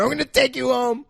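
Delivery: high, strained melodramatic promise; hammed-up war-movie cry; mock heroic distress. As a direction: imitate a rescuer with a dying comrade. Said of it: "as if I'm dying in his arms".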